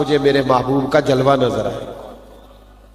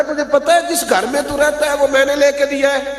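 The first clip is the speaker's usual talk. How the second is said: high, reproachful uncle reminding; taunting uncle; 12 semitones higher than usual. Acted. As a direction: rub in every favour you gave him. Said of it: "کہنے لگا".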